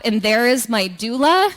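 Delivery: ordinary talk, nothing marked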